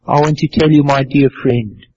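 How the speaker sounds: usual speaking voice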